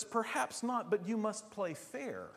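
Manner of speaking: lightly voiced admonishing mentor; admonishing guide. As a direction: gently raise the pitch to admonish. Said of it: "He says".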